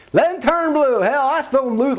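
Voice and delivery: high-pitched retold outburst; indignant protest. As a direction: protest to Mama, hammed-up.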